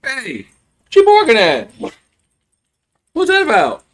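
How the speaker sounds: high, loud scolding call; yelling at the dog. Real, not acted. Owner shouting at his barking dog, loud and high. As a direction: bark back at the dog to hush it.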